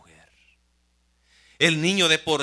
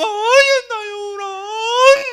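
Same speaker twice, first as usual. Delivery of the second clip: very high, louder, slow pitying cry; distressed gushing; 8 dB louder, slower than usual. Acted. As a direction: wail with pity over the baby. Daughter hears the baby cry.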